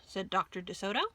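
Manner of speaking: plain reading voice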